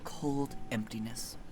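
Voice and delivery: soft, hushed ominous telling; eerie story narration. A narrator's reading. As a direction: draw them into the dread quietly.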